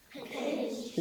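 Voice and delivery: whispered pinched, no voice; teacher whispering pinched for the class; whispered word. Shown as a demonstration. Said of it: "Say pinched".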